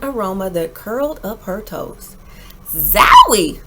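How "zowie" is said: loud burst of Zowie; surprised exclamation. Acted. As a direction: recoil from a sudden stink. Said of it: "said Ada".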